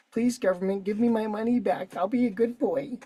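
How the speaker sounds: put-on high, pleading good-little-boy voice; mock begging. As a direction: mockingly beg like an obedient child. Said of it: "That's what he's doing".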